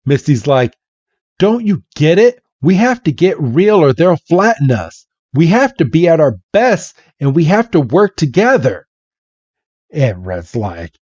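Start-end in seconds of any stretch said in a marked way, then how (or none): 1.4-8.9 higher-pitched, insistent appeal; acted agitated plea; urgent pleading. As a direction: press your partner to understand. Agitated partner pleads in acted quarrel.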